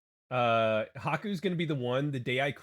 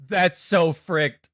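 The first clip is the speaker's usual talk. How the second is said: slow loud disbelief; 4 semitones higher, 9 dB louder, much slower than usual. Real, not acted. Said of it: "repeats it".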